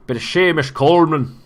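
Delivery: put-on botched Irish accent sounding northern English; bad Irish accent. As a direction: attempt Irish and land somewhere northern. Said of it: "That's supposed to be Irish".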